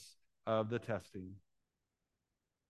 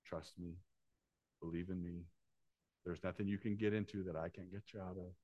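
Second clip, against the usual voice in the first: God's soft plea; 4 semitones lower, 9 dB quieter than usual. Acted. Preacher voicing God's plea softly.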